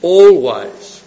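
"Always" punched loud and drawn out; stress on "always". Shown as a demonstration. Preacher repeating the key word for emphasis, stress on "always". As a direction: hammer "Always" as a word on its own. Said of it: "I emphasise that".

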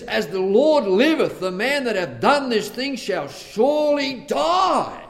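loud, high furious vow; David's furious royal decree; angry king David. Acted. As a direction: thunder out the death sentence. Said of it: "And he says".

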